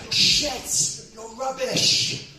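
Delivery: high, loud heckling yell; angry heckler. Retold or imitated. Pissed-off woman heckling the comic through his set, angry shout. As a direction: heckle with a drawn-out final jeer. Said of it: "she's like".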